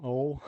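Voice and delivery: "Oil" echoed as "ole"; "Oil" without its i sound; imitated Southern "oil". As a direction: copy the Southern "Oil", one syllable like "ole". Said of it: "That's the way they pronounce it here".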